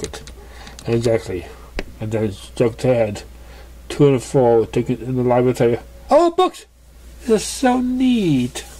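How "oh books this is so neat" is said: delighted. Acted. Pegasus thrilled by the library, very high. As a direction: gush over the books.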